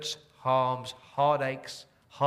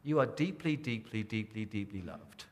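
fading to a hush; 3 semitones lower, 6 dB quieter than usual. Real, not acted. Fading tender assurance to congregation.

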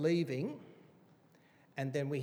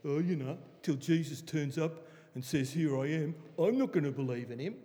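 imitating a sceptic. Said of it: "people talk like that".

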